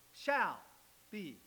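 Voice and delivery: "shall" loud and set apart, pause before "be"; stress on shall. Shown as a demonstration. Preacher showing the verse is future, stressing "shall". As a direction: land hard on "shall", then drop to "be". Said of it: "that's future, isn't it?".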